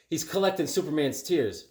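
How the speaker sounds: ordinary talk, nothing marked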